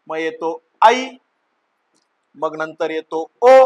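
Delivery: slow, drawn-out listing, pausing after each vowel; slow classroom dictation. Shown as a demonstration. Teacher dictating the vowel order to pupils, slow and drawn out. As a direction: spell each vowel out slowly for small children. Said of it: "मुलांसारखं म्हणायला लावतो".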